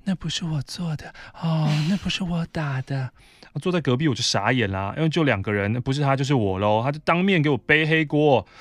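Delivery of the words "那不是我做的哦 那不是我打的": breathy denial. Retold or imitated. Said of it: "他立刻用气音说".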